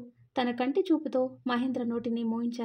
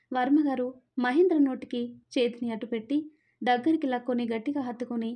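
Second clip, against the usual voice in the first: plain reading voice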